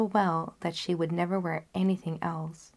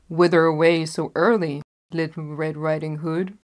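louder, lightly voiced wolf; wolf asking; 2 semitones lower, 7 dB louder than usual. Wolf questioning the girl, louder. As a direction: call out slyly to the girl.

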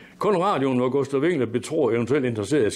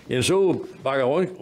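usual speaking voice

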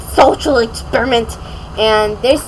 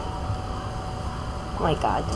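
scared whisper; 10 semitones lower, 14 dB quieter, much slower than usual. Real, not acted. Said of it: "I'm so scared".

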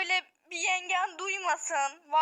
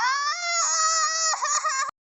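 high loud scream of fright; a girl's sudden frightened scream; terrified scream; 8 semitones higher, 4 dB louder than usual. Acted. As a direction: shriek at the monster. Child sees monster.

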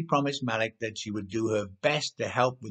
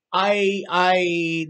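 higher, louder flustered stammer; blurted; 8 semitones higher, 7 dB louder than usual. A narrator's reading. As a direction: stumble out the words in shock. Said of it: "the writer blurted".

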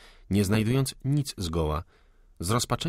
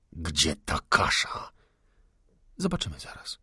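higher, cool and unafraid lady's voice; calm defiant young woman; 7 semitones higher than usual. Acted. Salomea answers the gunman, cool and defiant. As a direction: stand your ground, composed and proud.

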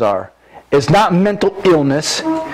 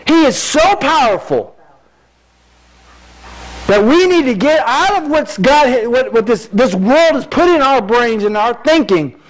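raised, fervent preacher voice; preaching at full force; 8 semitones higher than usual. Acted. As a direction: preach it out to the congregation.

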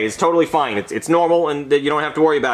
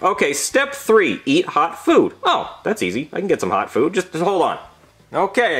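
ordinary delivery

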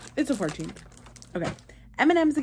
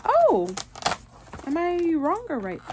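surprised; 9 semitones higher than usual. Real, not acted. Surprise opening Advent.

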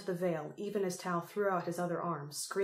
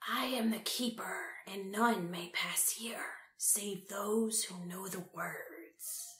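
slow, whispered spirit voice, starting higher; a menacing guardian, breathed out slowly; eerie Keeper voice; 2 semitones higher, slower than usual. A narrator's reading. The Keeper bars the way, slow eerie whisper. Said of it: "Its voice was high-pitched and screeching".

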